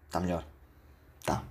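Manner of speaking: relieved after burping; quiet relief after burping. Real, not acted. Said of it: "Peço desculpa pelo arroto".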